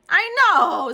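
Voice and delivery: high loud jeer; taunting agreement. A narrator's reading. Classmates piling on Junie, high and loud. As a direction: join in the teasing. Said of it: "said Polly and Puffer".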